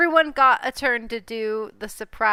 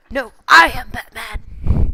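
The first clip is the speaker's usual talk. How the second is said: louder growled Batman claim; 8 dB louder than usual. Acted. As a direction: insist you're the real Batman. Players trade Batman lines.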